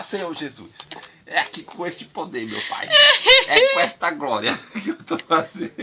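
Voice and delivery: hammed-up prayer, laughing through it; mock preacher. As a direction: pray like a pastor, giggling. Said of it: "E fala". (pastor rezando, rindo)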